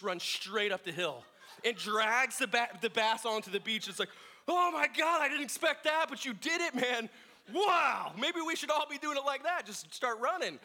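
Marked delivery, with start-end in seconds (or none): 4.5-10.6 high, loud amazed dad. Retold or imitated. Father cheering his son's wild fish catch, high and amazed. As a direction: marvel out loud, half laughing.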